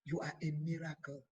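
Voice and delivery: ordinary talk, nothing marked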